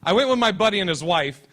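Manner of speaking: normal talking voice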